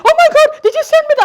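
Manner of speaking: imitating the son's startled reply; shocked son. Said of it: "And he's like".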